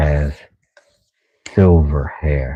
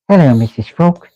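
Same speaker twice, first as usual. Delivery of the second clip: high chipper cartoon greeting; a lightly voiced cartoon greeting; 10 semitones higher than usual. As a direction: pipe it up cheerfully. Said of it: "where I get my accent".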